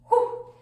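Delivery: short, loud blown-out breath; out-of-breath whew. Real, not acted. Speaker finishes a long passage, winded whew. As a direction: puff out after reading at speed. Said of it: "I'm already a little out of breath".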